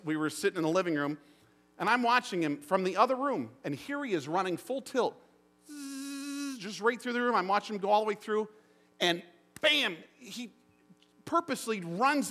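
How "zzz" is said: put-on high, soft zooming noise of a boy racing past; whoosh sound. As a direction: make the rush of a kid flying by. Boy running full tilt.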